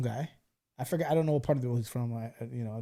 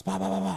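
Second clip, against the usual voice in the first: spoken gunfire noises, raised in pitch; imitated gunshots; 4 semitones higher than usual. Storyteller mimics shots heard in the video, imitated gunfire. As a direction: mimic a short burst of gunshots. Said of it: "three or four gunshots".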